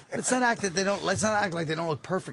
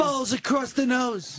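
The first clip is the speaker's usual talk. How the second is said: shouted; 6 semitones higher, slower than usual. Shown as a demonstration. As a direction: bellow it slowly. Repeating his own line louder.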